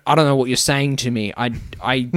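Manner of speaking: normal talking voice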